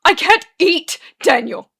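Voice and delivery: high, very loud outburst; yell. A narrator's reading. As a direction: yell it out in desperation. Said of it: "and he yells".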